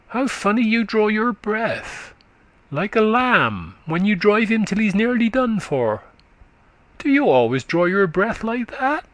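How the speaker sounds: put-on high, quick childish voice of a country boy; little boy chatting up to a tired woman, high and eager; chattering little boy. A narrator's reading. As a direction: prattle like a small eager lad. Boy notices her breathing.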